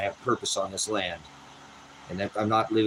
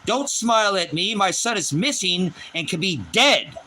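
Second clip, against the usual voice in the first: raised, angry voice, slowed on the second line; retold outburst; 7 semitones higher, 4 dB louder than usual. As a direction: tell him off hard, much higher and louder. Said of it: "I looked at him, I said".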